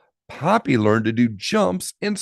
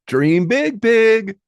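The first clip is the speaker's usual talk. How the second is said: high, louder cheer; cheering friend; 9 semitones higher, 6 dB louder, slower than usual. Acted. Emma cheering Poppy at the rink, high and loud. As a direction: cheer her on. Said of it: "she cheered".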